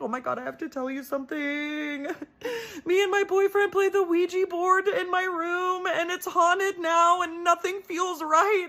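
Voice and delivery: high, tearful blurting, half laughing through sobs; crying confession. Retold or imitated. Teen confessing Ouija board to dad, high tearful crying. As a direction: wail it out to dad in a high teary voice. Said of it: "I started to cry".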